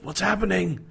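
high, acted panicky question; fearful cry. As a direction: cry it out as if frightened. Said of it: "really fearful kind of".

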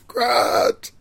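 high, strained imitated yell; drawn-up mock shout, very high; Hammond. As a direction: cry out the name as in the film. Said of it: "you're left with Hammond going".

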